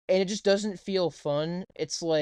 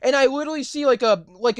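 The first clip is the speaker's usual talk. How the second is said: higher, louder fed-up ranting; 6 semitones higher, 5 dB louder than usual. Real, not acted. Complaining to friend he can't drive, loud fed-up rant. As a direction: shout your frustration. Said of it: "I'm tired of it".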